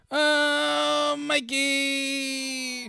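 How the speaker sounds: high drawn-out "Mikey" in a New York voice; mock New Yorker. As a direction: stretch out "Mikey" like a New York guy in the elevator. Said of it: "they were like".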